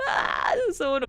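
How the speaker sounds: high, whiny wail of annoyance; hammed-up exasperated cry; mock exasperation. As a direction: wail in playful frustration. Acted exasperated reply to professors' corrections.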